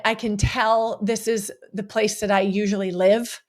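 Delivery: ordinary delivery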